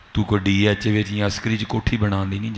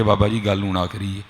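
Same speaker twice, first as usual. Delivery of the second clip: usual speaking voice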